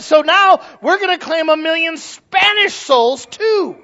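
high, slow, shouted mock proclamation; mock televangelist claiming. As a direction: stretch out an imitated triumphant claim. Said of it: "so then he claimed".

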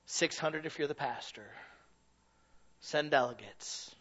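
usual speaking voice

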